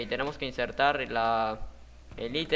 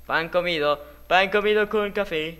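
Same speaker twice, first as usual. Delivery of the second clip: loud, higher-pitched cocky crowing; playful boasting; 4 semitones higher, 6 dB louder than usual. Real, not acted. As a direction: crow about how easy it is. Presume de un nivel fácil. (presumiendo en voz alta)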